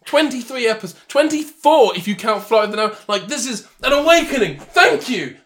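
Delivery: high, loud mock-grateful tirade; hammed-up, worked-up sarcasm; sarcastic gratitude. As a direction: thank them in theatrical indignation. Sarcastic thanks for being called unfunny.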